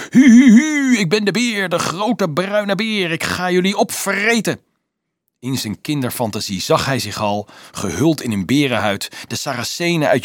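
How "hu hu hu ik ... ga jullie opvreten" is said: high howling child playing bear; little boy's playful monster roar; calling out as a bear. A narrator's reading. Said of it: "nog altijd roepend".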